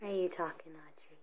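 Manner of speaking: whispering. Real, not acted. Parent whispers to child.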